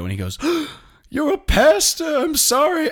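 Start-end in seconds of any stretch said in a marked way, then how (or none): 0.4-2.9 squeaky, much higher and louder mock panic; suddenly realizing he's cornered a priest; shocked apology. Guy shocked to see collar.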